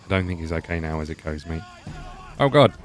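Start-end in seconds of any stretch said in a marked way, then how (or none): 2.4-2.7 startled oh god. Real, not acted. Startled by enemy attack.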